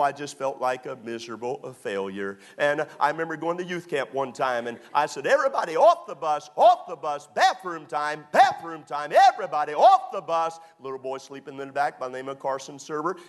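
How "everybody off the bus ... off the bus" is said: put-on loud call to kids on a bus. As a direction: shout it down the bus aisle.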